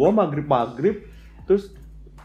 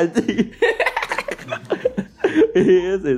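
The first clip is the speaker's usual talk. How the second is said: ordinary talk, nothing marked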